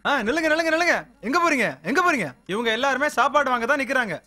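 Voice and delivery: shouting. Acted. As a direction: yell at them to stop. Stopping the crowd at the gate.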